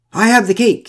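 high, loud taunting call; shout. A narrator's reading. Man with the cake lures the bear, shouting. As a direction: shout it out to draw attention. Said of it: "he shouted to the bear".

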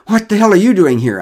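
loud, high breathless demand; gasp, then burst out in shock; startled, out of breath. A narrator's reading. Derek startled by neighbours on the path, winded and loud. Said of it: "a bit out of breath".

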